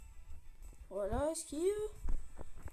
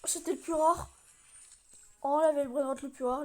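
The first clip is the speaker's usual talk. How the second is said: louder excited exclaim; 7 dB louder than usual. Real, not acted. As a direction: gasp out the rare find. Just got rarest item.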